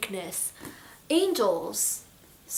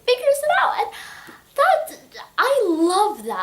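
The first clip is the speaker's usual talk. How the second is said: loud, high, laughing delight; 10 semitones higher, 5 dB louder than usual. Real, not acted. Reviewer laughing at a sweet scene, delighted. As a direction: laugh through it, charmed. Laughing about the heat trap.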